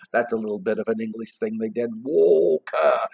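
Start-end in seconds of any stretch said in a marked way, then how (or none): 2.0-3.1 stretched "Walk her", raised pitch; surprised exclamation. Shown as a demonstration. Showing an old English saying, exclaiming in surprise. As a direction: draw out both words in surprise. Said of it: "when they were surprised".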